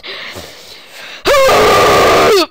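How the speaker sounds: high, loud, breathy gasp of shock. Real, not acted. Player reacting to the story ending, loud gasp.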